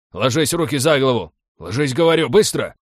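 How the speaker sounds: loud, high, urgent barked orders; hoarse yelled commands; hoarse commanding shouts. A narrator's reading. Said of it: "заорали наперебой глухими голосами с командной хрипотцой".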